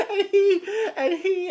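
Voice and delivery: loud, high laughter through the words; laughing. Real, not acted. Storyteller amused at the officer's defeat, laughing. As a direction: crack up while trying to talk.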